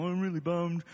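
low, lightly deflated moaning; mopey complaint. Retold or imitated. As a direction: grumble it like a gloomy Monday. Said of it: "that's like the bad version".

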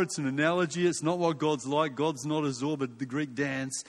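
ordinary talk, nothing marked

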